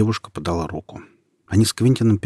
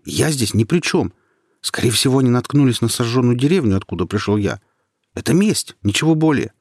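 urgent plea; 5 semitones higher, 5 dB louder than usual. A narrator's reading. Quentin pleads with raised hands.

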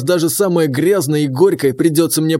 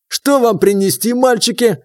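higher, sugary barmaid voice; tavern woman; 7 semitones higher than usual. Acted. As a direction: coo it like a big barmaid.

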